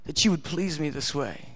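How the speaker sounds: breathed-out tender delight; voicing God. God answering a small prayer, hushed and tender. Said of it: "He goes".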